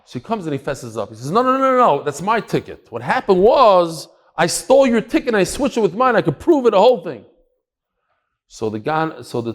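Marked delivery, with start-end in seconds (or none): 1.3-7.2 urgent confession. Retold or imitated.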